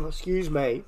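rough cough, then apology; coughing up water. Real, not acted. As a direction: cough again, still choking. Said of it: "that water went down the wrong way".

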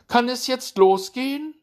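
very high, loud hare voice; cocky hare raring to race; eager hare. A narrator's reading. Hare impatient to start race.

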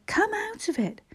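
high-pitched urging; urgent pleading. A narrator's reading. Brother shaking the fainted boy by the arm, urgent pleading. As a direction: rouse him with a high appeal.

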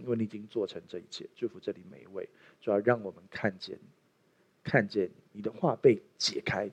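soft, low prayer voice. Acted. Pastor praying softly over the congregation, hushed prayer. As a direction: pray quietly to close.

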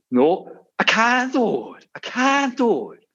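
very high, slow despairing donkey wail; whiny donkey. Retold or imitated. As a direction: drag it out like a defeated cartoon donkey. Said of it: "first donkey says".

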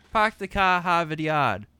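put-on loud, slow Boston accent, Rs dropped; mock Boston accent. Friend explaining a saying, in a mock Boston accent. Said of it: "It's like making fun of a Boston accent".

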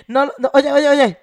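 ordinary talk, nothing marked

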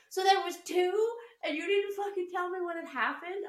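high, loud indignant questions; hammed-up furious disbelief; mother scolding her son about the second hole, high and loud; mock outrage. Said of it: "And I was like".

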